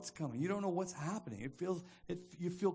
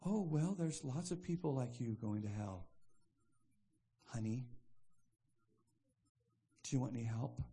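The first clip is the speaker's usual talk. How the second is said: low, mild passive aggression; unchanged pleasant expression; 5 semitones lower, 3 dB quieter than usual. Acted. Passive aggressive slipping in judgment, mildly and evenly. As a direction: deliver the jab evenly, as if kindly. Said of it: "they didn't even change expressions".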